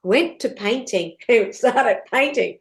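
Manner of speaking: ordinary talk, nothing marked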